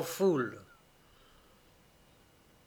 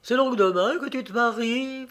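higher, mock-innocent question; ironic; 7 semitones higher than usual. A narrator's reading. Huguette meets the bride on the eve, ironic. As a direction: needle her with a lifted, ironic lilt. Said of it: "fit Huguette d'un ton ironique".

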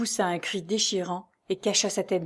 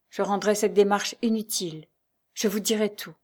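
ordinary delivery, nothing marked

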